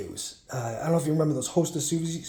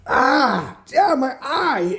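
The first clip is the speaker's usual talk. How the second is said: put-on pained child; 7 semitones higher, much slower than usual. Child hurt by hook, retold.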